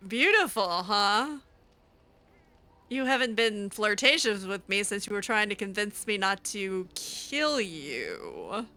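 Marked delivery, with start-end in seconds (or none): none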